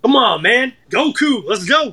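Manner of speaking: high-pitched, exuberant answer; excited fan. Real, not acted. Guest naming his favourite Dragon Ball character, excited. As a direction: cry it out as if it's obvious.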